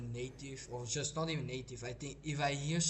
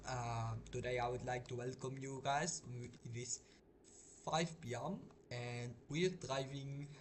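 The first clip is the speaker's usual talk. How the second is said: Indian accent; 4 dB louder than usual. Shown as a demonstration. Streamer welcoming viewers back to the truck simulator, imitated Indian accent. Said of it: "maybe a little Indian one".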